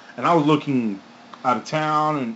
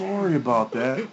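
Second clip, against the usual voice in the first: mock Canadian sorry. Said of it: "you almost sounded like".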